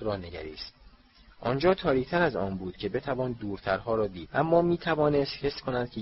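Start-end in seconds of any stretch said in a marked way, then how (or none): none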